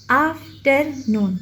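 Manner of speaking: आफ्टरनून slowly split आफ, टर, नून; three separate beats in आफ्टरनून; syllable by syllable. Shown as a demonstration. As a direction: pause between each piece of आफ्टरनून. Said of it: "टुकड़ों में क्यों करते हैं".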